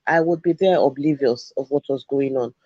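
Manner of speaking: ordinary delivery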